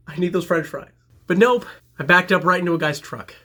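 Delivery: usual speaking voice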